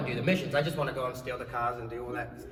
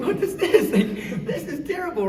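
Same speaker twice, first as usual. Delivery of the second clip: high-pitched, laughing mock dismay; incredulous, giggly retelling; laughing disbelief; 12 semitones higher, 5 dB louder than usual. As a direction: protest through laughter, pitched way up. Mock dismay at overheard remark.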